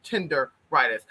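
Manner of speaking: "Tenderitis" slowly split as tender-ritis; deliberate mispronunciation. Shown as a demonstration. Host insisting to viewers, slow "Tenderitis" with an R. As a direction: draw out "Tenderitis" in two parts, R clearly sounded. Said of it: "We said it right".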